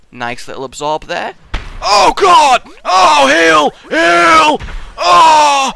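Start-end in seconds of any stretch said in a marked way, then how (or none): 1.8-5.7 high, loud panicked screams; panicked shouting. Real, not acted. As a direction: yell for a heal in panic. Near death in fight.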